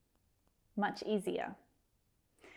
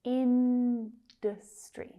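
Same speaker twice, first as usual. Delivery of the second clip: Industry slowly, with a long, strong IN; slow syllable-by-syllable Industry, IN strongest; first-syllable stress; 4 dB louder, much slower than usual. Shown as a demonstration. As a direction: stretch and punch the IN of Industry, then separate the rest. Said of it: "Stress on the first syllable".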